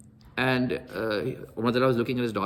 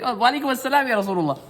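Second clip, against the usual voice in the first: high-pitched, eager greeting; hurried eager reply; 10 semitones higher, 3 dB louder than usual. Retold or imitated. Sa'ad rushes after the Prophet, eager reply. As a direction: call it out as if rushing after someone.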